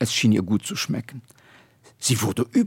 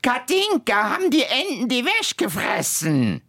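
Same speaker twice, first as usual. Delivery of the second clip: much higher shrieking call; screeching old woman; 10 semitones higher than usual. Acted. Old Guschke shrieking for the girl from the house. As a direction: yell the name like a cross old woman. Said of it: "schrie die alte Guschke".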